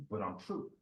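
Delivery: low, hushed challenge; quiet defiance. Acted. Man answers the universe after being tried, low and quiet. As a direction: drop low and very soft, unhurried.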